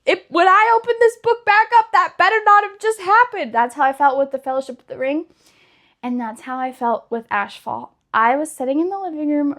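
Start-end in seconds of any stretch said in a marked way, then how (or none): 0.0-3.5 put-on reliving teenage shock in a raised, high voice; disbelieving cry. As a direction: plead with the book that it can't be true. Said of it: "you're just like".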